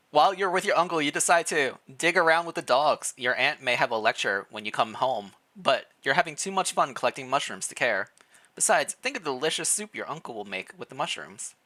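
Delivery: ordinary talk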